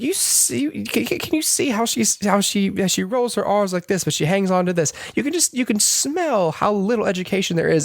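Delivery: high, far-off posh mockery of her speech; snooty professor. Higgins mocking Eliza before a crowd in a high, snooty voice. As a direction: sneer like a snobbish English professor. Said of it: "making fun of the way she talks".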